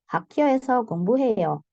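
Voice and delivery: brisk answer, 공부해요 falling at the end; statement intonation. Shown as a demonstration. Teacher answering her own example question, falling intonation on 공부해요. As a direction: let 공부해요 drop as a statement. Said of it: "jawaban itu nadanya turun".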